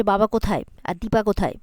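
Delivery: normal talking voice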